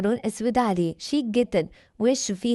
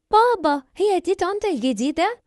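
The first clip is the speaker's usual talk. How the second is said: put-on much higher and louder, a six-year-old girl; little girl voice; 9 semitones higher, 3 dB louder than usual. A narrator's reading. Young daughter asking her father about the new wife, childlike high voice. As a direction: pipe up like a small child. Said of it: "اللي عندها حوالي ست سنين".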